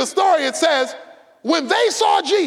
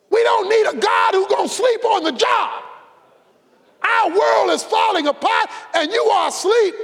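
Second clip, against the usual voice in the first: panicked disciples; 5 semitones higher than usual. Acted. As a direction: cry out to a sleeping master in terror. Disciples begging Jesus in storm.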